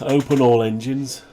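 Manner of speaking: ordinary delivery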